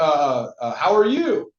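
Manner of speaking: stumble, then toss the question back, imitating surprise; caught off guard. Imitating a prospect answering a cold caller, caught off guard. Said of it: "they'll be caught off guard. You'll hear it in their tone".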